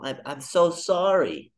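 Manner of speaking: slow, pitying sorry; mock pity. Stranger offering slow mock pity. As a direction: fake sympathy, drawn out. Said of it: "this look like".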